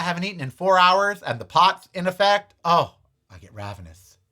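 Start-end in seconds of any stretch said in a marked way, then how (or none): none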